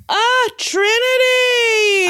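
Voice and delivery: high, slow, shouted cheer; excited shout. Real, not acted. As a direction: cry out the name, thrilled. Cheering for a contestant.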